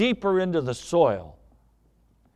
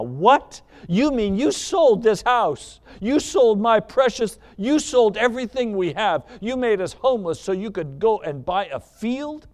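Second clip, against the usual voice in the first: higher-pitched, incredulous acted outrage; mad wife; 4 semitones higher than usual. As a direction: pile up accusations, pitch raised in disbelief. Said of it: "I mean, she was mad".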